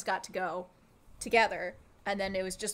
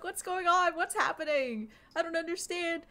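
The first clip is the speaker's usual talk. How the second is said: higher-pitched imitation of panicked bystanders; mock freaking out; 8 semitones higher than usual. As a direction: act out strangers starting to panic. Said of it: "I hear them start freaking out".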